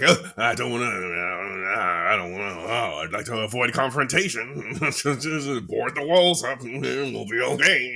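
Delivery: drawn-out, reluctant character voice; hammed-up dodging of a confrontation, words stretched out; Beetlejuice impression. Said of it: "that was an impression that happened".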